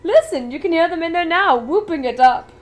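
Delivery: a giddy chocolatier showing off; Willy Wonka, grand and eager; eccentric Wonka. Acted. Wonka voiced, story reading.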